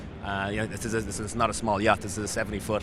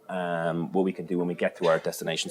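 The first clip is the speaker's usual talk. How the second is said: ordinary delivery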